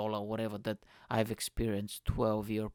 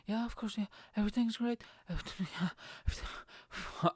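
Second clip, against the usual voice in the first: high, strained pretend cheer; badly faked enthusiasm, trailing away; faked enjoyment; 9 semitones higher than usual. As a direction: insist all is fine in a squeaky voice. Faking fun while terrified.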